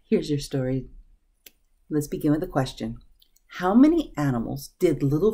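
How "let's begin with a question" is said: ordinary delivery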